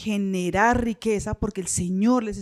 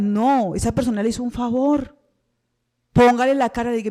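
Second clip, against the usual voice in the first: ordinary talk, nothing marked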